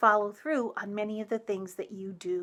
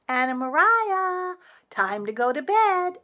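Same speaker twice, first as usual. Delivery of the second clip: calling to bed; 9 semitones higher, 6 dB louder than usual. Acted. Reading the story aloud.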